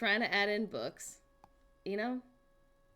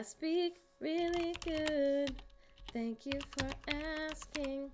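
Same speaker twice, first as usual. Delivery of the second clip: higher, slow, self-satisfied voice; mock posh pride; 6 semitones higher, slower than usual. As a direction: boast slowly and grandly about speaking well. Mock-proud of her voice.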